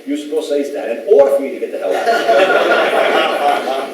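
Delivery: talking through a laugh. Real, not acted. Host laughing while retelling the ranger story. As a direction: laugh while telling the punchline.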